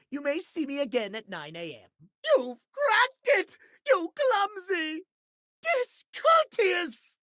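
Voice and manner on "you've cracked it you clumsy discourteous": much higher, slow, drawn-out outrage; outraged scolding. Acted. Cracked pocket watch scolding its careless owner, slow outraged cry. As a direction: spit out each insult slowly.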